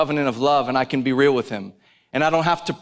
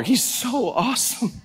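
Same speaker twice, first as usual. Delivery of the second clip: ordinary delivery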